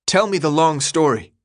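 calling out. A narrator's reading. New boy asking for the whole story, calling out. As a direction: press for answers, voice held in check. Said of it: "Thomas called out, struggling to keep his voice even".

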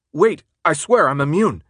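high, loud protest at gunpoint; urgent pleading. Acted. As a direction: plead your case urgently.

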